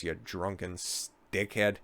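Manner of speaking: quiet, slow jab; muttered insult. Real, not acted. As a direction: mutter it under your breath.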